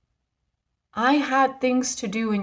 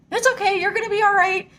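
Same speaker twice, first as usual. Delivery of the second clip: put-on high, bright-eyed kid reassuring a grown-up; little kid voice; 10 semitones higher, faster than usual. Kid on the ride telling her she'll be fine, high child voice. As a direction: pitch way up like a confident child. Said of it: "he's like".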